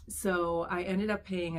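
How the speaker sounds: ordinary talk, nothing marked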